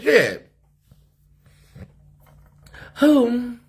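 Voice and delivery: ordinary delivery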